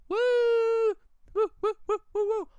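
high cheering woos; excited whoops. Real, not acted. Cheering the couple on screen with high whoops.

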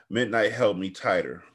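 ordinary delivery

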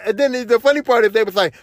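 usual speaking voice

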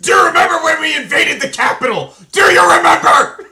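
loud high mock-nostalgic shout. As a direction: bellow the question at everyone.

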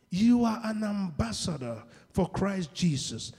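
ordinary talk, nothing marked

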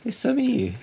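high "It's me" like Mario. Retold or imitated. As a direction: squeak it out like Mario. Mario voice guess.

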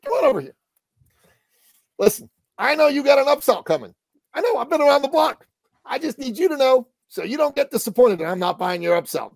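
high, loud imitation of a pushy man; in-your-face guy. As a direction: confront them with comic bluntness. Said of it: "he just got in my face".

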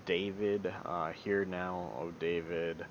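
normal talking voice

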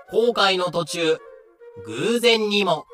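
ordinary talk

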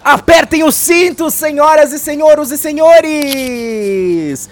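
slow, loud cabin announcement; flight attendant. Acted. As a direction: buckle up, airline announcer style. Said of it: "Tá começando agora uma viagem". (comissária de bordo anunciando)

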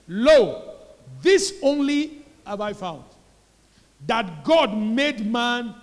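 high, loud, slow, driven preaching; preaching at full force. Real, not acted. The pastor drives the point into the congregation, preaching loud. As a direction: hammer each word of the definition home.

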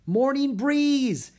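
high, slow, drawn-out joyful call; girl greeting the rescuing elephant, high and slow; cried out gladly. Acted. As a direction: cry the elephant's name with relief. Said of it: "Annie cried".

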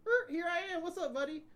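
high, loud pop-up cop greeting; spring out like a pleased cop, pitched high; mock traffic cop. Cop surprising a driver over the hilltop, high mock greeting. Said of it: "there's a cop waiting".

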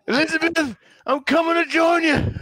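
high, loud mock dying wail; hammed-up, high-pitched cry to heaven; sitcom heart-attack cry. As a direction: call out melodramatically to a late wife. Acts out Sanford and Son catchphrase.

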